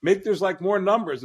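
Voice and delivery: high, fast-excited retold gushing; quoting a guy. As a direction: gush like a fan of bad war wisdom. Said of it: "a guy said to me one time".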